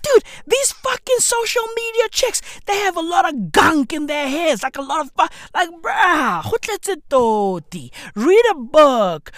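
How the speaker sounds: ordinary talk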